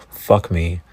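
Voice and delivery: ordinary talk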